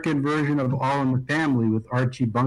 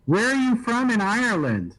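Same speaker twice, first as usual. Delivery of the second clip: much higher, eager American asking; mock American tourist; 9 semitones higher than usual. Mocking Americans who misplace his accent, high American voice. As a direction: play the clueless American, pitched up. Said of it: "where most people said that they thought I was from".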